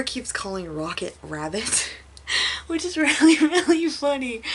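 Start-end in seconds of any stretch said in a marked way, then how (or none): 2.3-4.4 breaking into high, loud laughter mid-sentence; cracking up while saying how funny it is; laughing. Real, not acted. Laughing while recounting funny scene.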